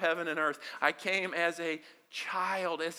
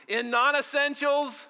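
In non-essentials called out higher and louder; 7 semitones higher, 4 dB louder than usual. Shown as a demonstration. Pastor leads the congregation in a louder call. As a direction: lead the chant, push them on "In non-essentials". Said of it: "come on, y'all can do better than that".

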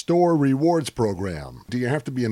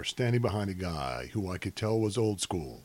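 ordinary talk, nothing marked

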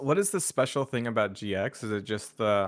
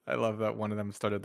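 ordinary delivery

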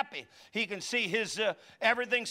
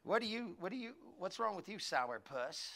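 nagging mom; 10 dB quieter than usual. Retold or imitated. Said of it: "she'd go".

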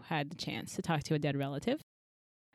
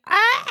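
excited yell; 10 dB louder than usual. Retold or imitated. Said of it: "are like".

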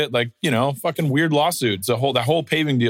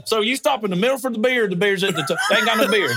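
hillbilly racer voice; 8 semitones higher than usual. Retold or imitated. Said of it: "Yeah, yeah, exactly".

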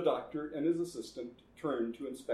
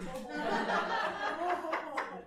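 very high cackle. Acted. Laugh after councilman's question.

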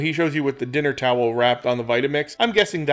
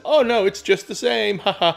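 higher, forced cheerful cover-up with a fake laugh; mock fake cheer; 7 semitones higher, 3 dB louder than usual. Imitating a cook covering a bad taste, forced cheer. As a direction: imitate a man hiding that he dislikes the soup. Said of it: "he speaks and says".

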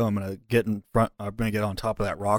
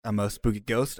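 ordinary delivery

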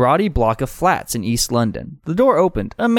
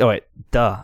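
ordinary talk, nothing marked

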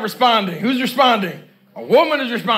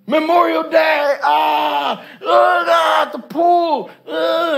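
mock party cheer; 4 semitones higher, slower than usual. Mocking carefree holiday.

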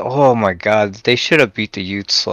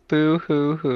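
fake crying; 4 semitones higher, 3 dB quieter, slower than usual. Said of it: "Poor guys".

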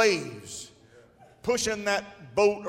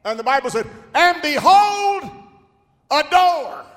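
preaching at full force; 9 semitones higher, 12 dB louder than usual. Acted. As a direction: ring it out to the back pews.

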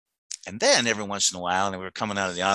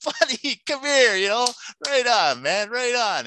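high, bright-toned and louder; joyful greeting; 13 semitones higher, 3 dB louder, slower than usual. Retold or imitated. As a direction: welcome him like an old friend. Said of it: "I went".

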